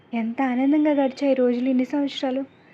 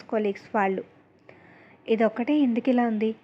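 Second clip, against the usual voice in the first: ordinary delivery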